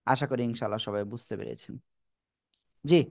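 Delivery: ordinary talk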